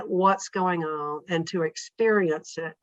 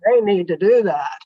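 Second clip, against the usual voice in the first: said louder and firmly; firm, louder advice; louder, insistent; 8 dB louder than usual. Retold or imitated. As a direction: insist on it more strongly. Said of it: "And to the next person".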